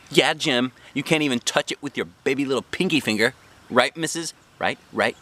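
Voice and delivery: put-on a bratty kid rubbing it in; lightly voiced little girl; Junie B voice. A narrator's reading. Junie B taunting Jim.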